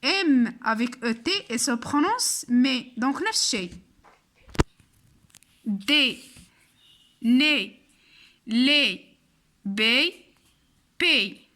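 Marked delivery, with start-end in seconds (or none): 5.6-11.5 "دي، ني، لي، بي" each ending in a closed é, set apart; chant-free drill of single syllables, a pause after each; teacher sounding out "دي، ني، لي" one at a time. Shown as a demonstration. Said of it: "هاد القاعدة ديال إي".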